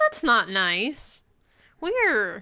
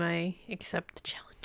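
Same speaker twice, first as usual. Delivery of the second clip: hushed accepting the challenge; whispered vow, almost silent; 6 semitones lower, 13 dB quieter than usual. Acted. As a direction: breathe the words. Dramatic acceptance.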